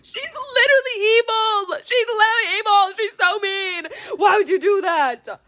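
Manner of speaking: loud, high-pitched protest; outraged wailing. Real, not acted. Reader protesting the mean housekeeper, outraged wailing. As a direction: wail the accusation at the top of your voice.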